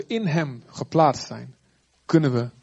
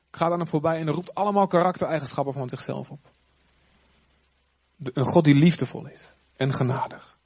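usual speaking voice

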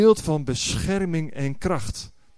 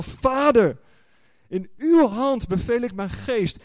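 joyful cry; 6 semitones higher than usual. Retold or imitated. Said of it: "Dat hij zegt van".

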